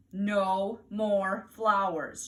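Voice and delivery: very slow, loud firm warning; stern slow warning. Acted. Mother warns Fudge after the doctor call, very slow loud warning. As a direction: spell it out to the toddler. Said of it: "she told Fudge".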